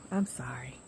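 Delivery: whispered sorry. Real, not acted. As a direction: breathe an apology. Apologizing after loud laugh.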